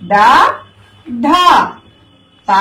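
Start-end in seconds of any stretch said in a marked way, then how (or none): 1.3-1.3 ఢ voiced with a heavy breath release; aspirated letter. Shown as a demonstration. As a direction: push breath through ఢ. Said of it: "ఒత్తులు పలికే అక్షరాలు". (ఢ ఒత్తి పలకడం)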